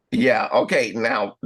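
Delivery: usual speaking voice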